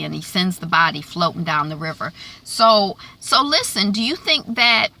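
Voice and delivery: normal talking voice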